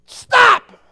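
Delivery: high, loud order to halt; sharp shout; shouted command. Acted. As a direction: command the storm at full voice. Jesus commanding the wind.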